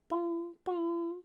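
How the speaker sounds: high ringing "bong, bong"; knocking imitation. Game master shows the tiefling knocking on the dome, ringing imitation. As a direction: sing out two hollow, high knocks. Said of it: "knocks against the dome itself".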